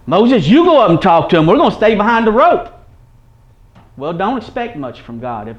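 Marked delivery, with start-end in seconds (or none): 0.0-2.7 loud, high-pitched mock pleading; raised voice imitating a reluctant crowd; acted fearful Israelites. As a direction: play the people pushing Moses forward, pitched way up. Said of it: "you do like they did".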